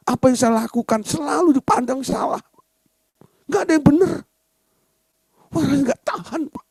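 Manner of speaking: high-pitched aggrieved whine; acted exasperation, pitched high; fed-up complaint.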